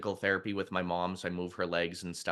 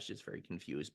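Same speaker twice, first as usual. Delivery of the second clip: ordinary talk, nothing marked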